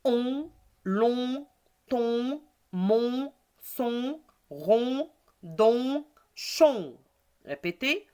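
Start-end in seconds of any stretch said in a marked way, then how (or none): 0.0-7.0 each syllable drawn out separately, list intonation; teacher's syllable drill, rising until the last; reading syllables. Shown as a demonstration. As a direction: read syllable by syllable, held vowels. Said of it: "On lit les syllabes".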